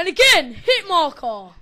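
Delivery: real frustrated gamer shout; exasperated shout. Real, not acted. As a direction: vent at the screen. Exasperated yell at a hit marker.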